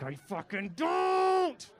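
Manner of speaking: high, long-drawn-out shout; mock tantrum shout. Grown child answering mother's teasing, tantrum shout. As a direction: wail it like a thwarted toddler.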